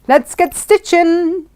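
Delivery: high, drawn-out cheer; rally everyone slowly and high; playful, stretched-out battle cry; gleeful rallying call. Real, not acted. Gleeful rallying call before sewing.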